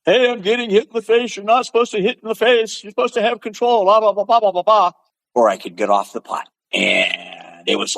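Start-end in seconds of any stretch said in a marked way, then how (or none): 0.0-4.9 high, whiny complaining voice; nasal mock complaint, pitched up high. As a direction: whine like a sore loser about fair play. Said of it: "gotten whiny about the contact".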